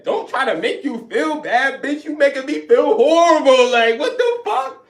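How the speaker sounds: furious high yell; outraged yell. Retold or imitated. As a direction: scream it in disbelief. Outraged retort.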